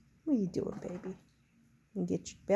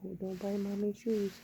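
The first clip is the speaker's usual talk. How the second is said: ordinary delivery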